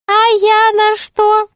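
very high, slow jester voice; drawn-out, high jester question; high-voiced jester. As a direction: ask slowly in a piping jester pitch.

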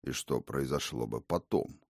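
low, soft, poisonously ironic; venomous irony. A narrator's reading. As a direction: needle her with irony. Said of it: "С ядовитой иронией".

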